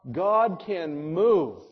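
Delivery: ordinary talk, nothing marked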